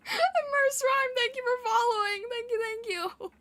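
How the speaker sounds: squealing, excited thank-you; excited high thanks. Real, not acted. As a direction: gush your thanks up high.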